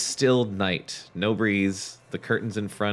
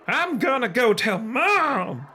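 declared; 11 semitones higher, slower than usual. A narrator's reading. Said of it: "Lindy declared".

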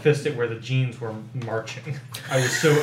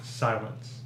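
plain reading voice

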